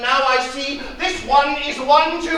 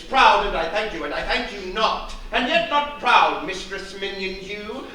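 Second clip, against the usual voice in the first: ordinary talk, nothing marked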